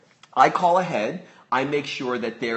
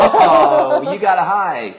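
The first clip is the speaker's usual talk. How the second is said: delighted cooing; 8 semitones higher, 11 dB louder, slower than usual. Real, not acted. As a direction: burst into proud, high-pitched praise. Delighted reaction to baby's greeting.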